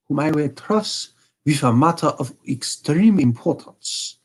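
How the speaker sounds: a hint of a haughty German king; Bohemian king. Voicing the King.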